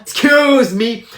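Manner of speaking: slow, drawn-out English excuse me; English accent. Acted. Pupil apologises to the teacher in a slow English accent. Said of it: "je suis allé deux semaines en Angleterre".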